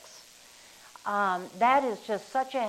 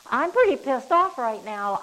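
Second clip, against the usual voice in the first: louder, higher demo of being pissed off; mock angry outburst; 4 semitones higher, 8 dB louder than usual. Shown as a demonstration. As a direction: act out the anger for the example, hammed up a bit. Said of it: "I just used that word".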